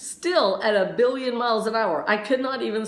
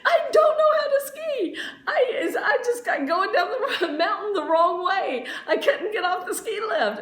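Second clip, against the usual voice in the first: desperate admission; 11 semitones higher than usual. Retold or imitated. Beginner telling classmates she can't ski, high desperate laugh. Explaining her accident to classmates.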